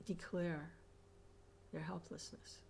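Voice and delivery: ordinary talk, nothing marked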